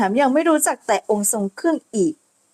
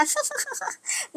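sly giggle; 13 semitones higher, 12 dB quieter than usual. Acted. Hania laughs at the soaked ladies.

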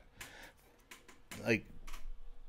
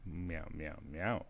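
imitated meows; 4 semitones lower, slower than usual. Real, not acted. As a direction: meow back at the kitty. Meowing at the cat.

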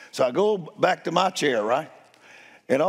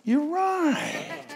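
mock high agreement; 12 semitones higher than usual. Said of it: "I said".

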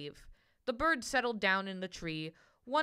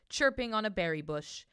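plain reading voice

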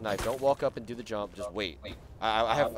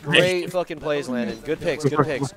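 excited praise; 11 dB louder, slower than usual. Real, not acted. Praising a teammate after the round.

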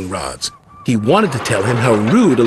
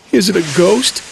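a touch of nerves, pitched up; mildly nervous, higher-voiced question; 6 semitones higher than usual. A narrator's reading. Thomas asks his driver about the shed, nervous. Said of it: "asked Thomas nervously".

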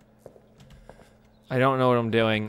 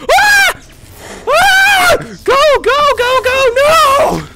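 very high shrieks at full volume; real terror screams; terrified screaming; 13 dB louder, much slower than usual. Real, not acted. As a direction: scream as it catches you. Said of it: "I screamed like a girl".

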